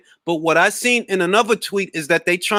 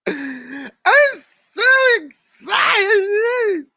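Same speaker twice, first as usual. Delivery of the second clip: very slow, high crying shout; Jesse's breakdown hammed up, very slow; mock hysterical crying; 12 semitones higher, much slower than usual. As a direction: drag out the sobbing wail.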